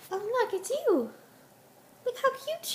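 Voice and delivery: high delighted coo. Real, not acted. Mother cooing high as baby sees itself.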